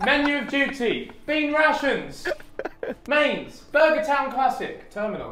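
high, hammed-up menu read; announcer voice. Reading menu in role.

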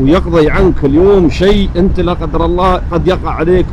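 ordinary talk, nothing marked

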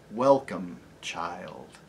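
low, soft and deep lion; gentle great lion greeting a child; low, tender lion voice; lion's voice. Acted. Aslan voiced.

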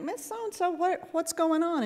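concerned surprise. Retold or imitated. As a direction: catch her by name, startled and worried. Nurse sees patient walking slowly.

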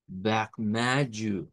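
"vermelho" slow, guttural r; slow model. Shown as a demonstration. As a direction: model for repetition. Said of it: "Una vez más". ("vermelho" lento para repetir)

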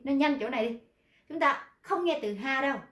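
normal talking voice

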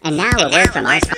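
low, fast announcer voice; deep announcer voice. Retold or imitated. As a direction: rattle it off like a deep radio announcer. Copying the app's sponsor announcement.

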